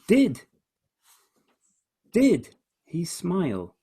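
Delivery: high, emphatic "Did", long pause, repeated; questioning line with high stressed "did". Shown as a demonstration. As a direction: punch "did" high as a challenge. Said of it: "It's a question".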